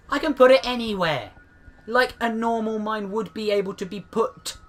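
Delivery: openly irritated with the mines; annoyed. Real, not acted. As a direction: let the frustration with the mines show. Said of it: "if I sound like I'm annoyed, but I am".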